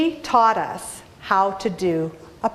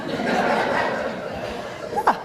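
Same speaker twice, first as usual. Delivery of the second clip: breathy laugh; 5 dB quieter than usual. Real, not acted.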